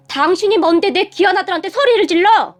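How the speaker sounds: high, angry challenge; indignant outburst. Acted. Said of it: "저에게 삿대질을 하기 시작하더니". (높은 목소리로 발끈하며)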